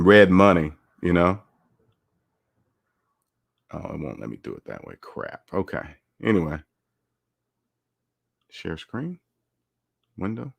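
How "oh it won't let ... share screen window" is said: soft, absent-minded mumble; barely audible talk under the breath; muttering to self. Real, not acted. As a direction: grumble quietly at the computer. Muttering while screen share misbehaves.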